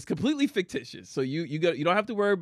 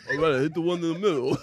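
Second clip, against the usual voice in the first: Rocky impression; faster than usual. Acts Rocky answering the cornerman.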